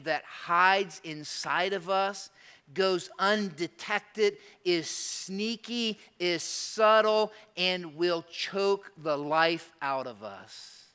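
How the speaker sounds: slow ominous. Real, not acted. Describing creeping greed slowly.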